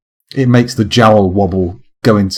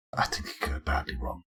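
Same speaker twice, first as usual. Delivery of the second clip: hushed, voiceless whisper; very soft confidential whisper; whispered aside; 19 dB quieter than usual. Real, not acted. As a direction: whisper it under your breath. Muttered aside, then repeated aloud.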